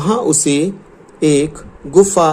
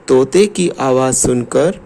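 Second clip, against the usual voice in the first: ordinary delivery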